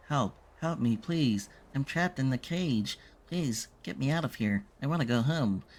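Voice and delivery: put-on a trapped child begging to be let out; little boy. Trapped child voiced in game.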